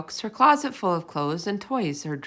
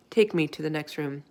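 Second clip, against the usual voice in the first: plain reading voice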